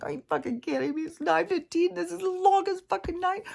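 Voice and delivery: high-pitched, whiny mock complaint; exaggerated whiny griping. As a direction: whine it way up high, imitating your grumpy waking self. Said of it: "I was being real whiny".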